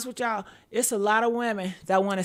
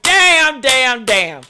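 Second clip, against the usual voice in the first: exasperated outburst; 9 dB louder, much slower than usual. Acted. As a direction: shout in exasperation. Acting out exasperation at advances.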